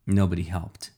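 plain reading voice

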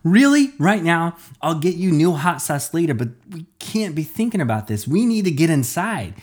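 high, loud incredulous shout; exasperated outburst. A narrator's reading. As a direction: burst out in disbelief at the delay.

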